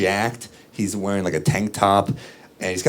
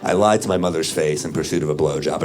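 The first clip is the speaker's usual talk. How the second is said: ordinary delivery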